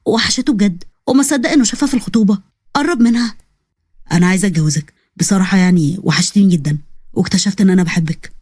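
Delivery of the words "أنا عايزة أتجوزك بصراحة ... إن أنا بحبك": lower, hesitant man confessing love; lightly lowered young man in love; shy confession. Acted. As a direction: admit it almost reluctantly, lower. Nabil confesses to Zeina.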